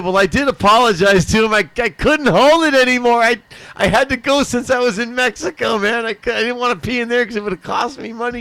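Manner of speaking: high, loud nervous excuse; pleading excuse. Retold or imitated. Explaining to the cop why he peed, a high nervous plea. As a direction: talk fast and high, scrambling to explain. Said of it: "And I said".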